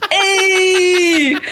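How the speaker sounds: high, drawn-out mock cheer; delighted greeting call. Retold greeting over matching band shirts.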